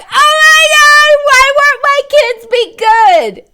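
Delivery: frantic parent shouting, slowing on the complaint; exasperated yell. Retold or imitated. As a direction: yell it like a parent at the end of their rope.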